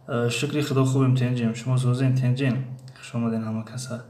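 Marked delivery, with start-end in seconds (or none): none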